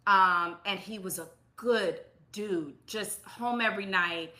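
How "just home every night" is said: usual speaking voice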